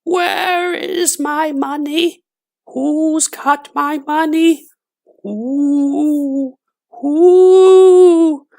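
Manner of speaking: very high, slow, eerie ghostly wail; ghost crying. A narrator's reading. Dead woman's ghost calling for her money, high eerie wail. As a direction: moan like a far-off ghost hunting her money. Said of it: "It was a voice crying".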